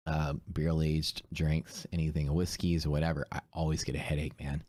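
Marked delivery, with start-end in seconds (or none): none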